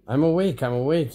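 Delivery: jolted awake, yelling back; mild yell. A narrator's reading. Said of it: "yelled Darlin".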